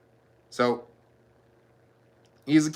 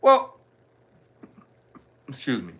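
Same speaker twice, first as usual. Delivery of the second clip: usual speaking voice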